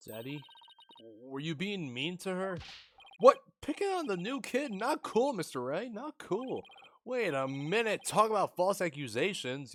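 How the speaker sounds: put-on high, bright teasing girl voice; teasing young girl. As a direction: scold him playfully like a young girl. Voices teasing daughter character.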